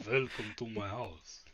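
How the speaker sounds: teasing the low, slow hostess voice; mock deep voice. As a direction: lightly parody the deep greeting. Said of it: "That's a very deep voice".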